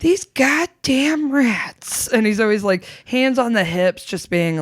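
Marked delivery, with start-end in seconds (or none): 0.0-2.1 slow, high mock grumble of an old neighbour; grumpy landlord voice. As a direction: drag it out like a cranky old man. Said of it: "just like".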